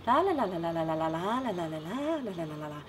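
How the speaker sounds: sing-song Northern Irish la la line with an up and down tune; lilting la la. Shown as a demonstration. Northern Irish lilt shown on la la syllables for the interviewer. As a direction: let the la la la rise and fall like Irish speech. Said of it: "That's lilt".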